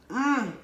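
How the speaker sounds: high, loud appreciative mmm; savouring hum. Real, not acted. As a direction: hum with relish over the food. Savouring another bite of food.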